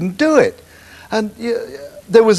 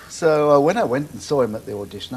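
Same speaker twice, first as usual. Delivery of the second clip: ordinary delivery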